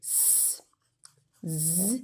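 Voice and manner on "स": "स" as a bare hiss, no voice; hissed s sound. Shown as a demonstration. Teacher listing the three plural sounds for students. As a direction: let the "स" hiss out softly. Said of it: "तीन किस्म की sounds होती हैं प्लूरल्स में".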